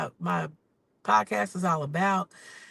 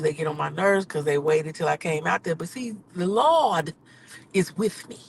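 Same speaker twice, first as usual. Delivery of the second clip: ordinary talk, nothing marked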